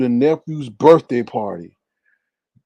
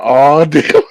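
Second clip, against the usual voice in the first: laughing realization; 2 semitones higher, 10 dB louder than usual. Retold or imitated. Realizing what's in the box.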